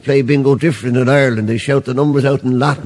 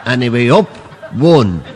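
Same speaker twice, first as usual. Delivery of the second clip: bingo caller; 3 semitones higher than usual. Acted. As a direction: call the numbers to the whole hall. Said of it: "the priest had called the numbers".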